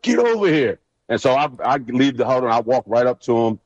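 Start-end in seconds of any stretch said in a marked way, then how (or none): 0.0-0.8 high mock yell; a hint of a coach barking him over; mock coach call. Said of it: "see B C coming up the sideline".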